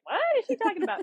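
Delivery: mock the puzzled classmates; baffled classmates. Classmates mocking her odd answer, incredulous and high.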